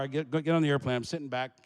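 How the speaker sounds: usual speaking voice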